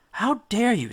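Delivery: put-on high, affronted girl's voice; a startled maiden scolding, pitched high; indignant young woman. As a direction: tallest girl catching a stranger, high and indignant. Startled bathers confront intruder.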